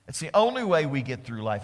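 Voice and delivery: normal talking voice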